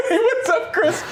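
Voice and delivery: high, laughing hello; mock-casual greeting. Driver greeting friend picked up, high mock-casual greeting.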